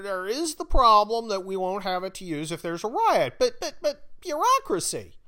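high, loud mocking official; mock bureaucrat. Bureaucrat proposing to centralize all the ammunition, mocking voice. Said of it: "It's the bureaucratic mindset".

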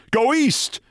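high, urgent shout; crowd cry. A narrator's reading. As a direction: call the crowd east in panic. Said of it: "was the cry that arose".

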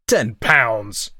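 put-on old woman scorning ten pounds; screamed. A narrator's reading. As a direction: scream the outrage. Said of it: "she screamed and raved at him".

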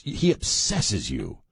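usual speaking voice

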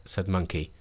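plain reading voice

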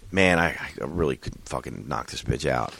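muttered thought. Retold or imitated. Quoted violent private thought.

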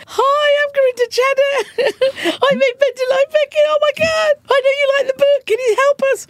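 very high, fast, laughing fangirl gush; giddy high squeaky pitch to her idol; starstruck gush. Shown as a demonstration. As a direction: blurt it out like a starstruck fan. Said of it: "it actually came out more like".

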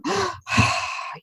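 a big exaggerated sigh, pitched high and falling; mock deep sigh. Daughters teasing mom with a mock deep sigh. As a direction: sigh like a weary mother, hammed up. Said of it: "make fun of me by going".

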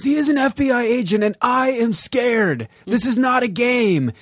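high, put-on movie-quote voice; hammed-up frightened line reading; mock scared. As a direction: act out a scared character in a high voice. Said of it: "he goes".

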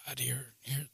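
hushed, breathless whisper. Retold or imitated. Worried man whispering to himself about his symptoms. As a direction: whisper it like you sense something wrong.

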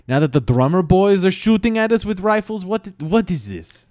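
a hint of an outraged Russian; lightly voiced Russian soldier. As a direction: bluster as a put-upon Russian officer. Russian officer complaining in character.

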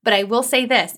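ordinary talk, nothing marked

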